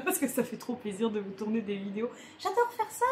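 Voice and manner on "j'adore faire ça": squealed high and giddy; excited. Real, not acted. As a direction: gush it out, way up high. Gushing about loving filming.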